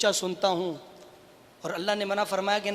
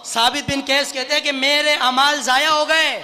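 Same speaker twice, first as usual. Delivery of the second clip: loud, raised-pitch pulpit cry; preaching outcry; 5 semitones higher, 9 dB louder than usual. Retold or imitated. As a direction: proclaim the lament to the crowd. Preacher cries out companion's lament.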